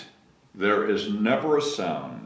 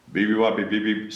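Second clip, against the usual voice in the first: sighing; 3 semitones lower, faster than usual. A narrator's reading. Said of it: "sighed the bear".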